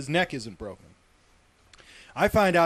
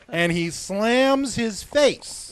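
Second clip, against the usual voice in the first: slow, higher, leaning on "slams"; dramatic slow emphasis; 6 semitones higher, much slower than usual. Acted. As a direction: drag out "And he slams his face". Dramatic story climax.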